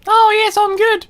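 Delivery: very high, far-off dragon voice. Pretend. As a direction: squeal it out as a cartoon dragon. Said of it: "as the voice of a dragon".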